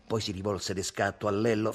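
usual speaking voice

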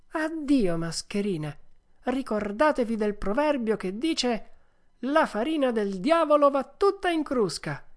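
high, emphatic puppet voice; scornful Pinocchio. Acted. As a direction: preach a proverb at swindlers and dismiss them. (burattino sprezzante, voce acuta e sentenziosa)

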